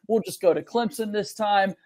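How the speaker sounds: ordinary talk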